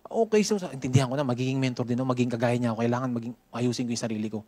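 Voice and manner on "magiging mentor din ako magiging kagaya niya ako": normal talking voice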